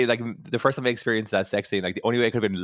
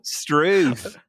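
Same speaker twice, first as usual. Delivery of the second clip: mock Australian accent; 6 semitones higher, 3 dB louder than usual. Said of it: "the Americans were clearly Australian".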